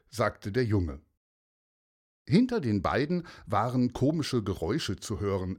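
plain reading voice

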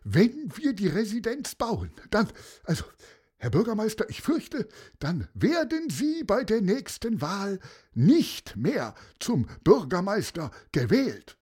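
very high, anxious aide voice; fretting adviser. Acted. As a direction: stammer out the bad news nervously. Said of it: "regte sich der Berater auf".

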